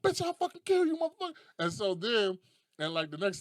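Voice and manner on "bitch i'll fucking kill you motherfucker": loud, high comic rage; mock furious threat. Guy pulls a gun in quarrel, mock furious. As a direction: threaten in an over-the-top high voice.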